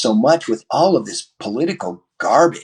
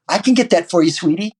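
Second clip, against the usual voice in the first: lightly voiced sweet delivery girl, higher; a hint of a cheerful young woman; higher, louder sweet offer; friendly delivery woman; 7 semitones higher, 4 dB louder than usual. Delivery woman quoted kindly.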